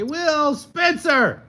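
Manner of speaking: high, loud shout of a colleague's name; loud call. Real, not acted. Co-anchor yelling to throw to the reporter, a loud high call. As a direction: call her name out big and bright. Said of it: "[name] just yelled, "Spencer!".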